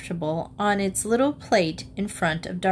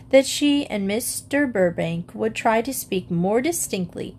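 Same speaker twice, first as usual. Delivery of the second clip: plain reading voice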